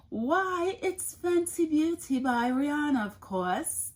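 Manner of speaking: high, posh-mock British lilt with a laugh; fake British accent. Answering a follower's question in a jokey fake British accent. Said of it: "That's my fake British-like accent".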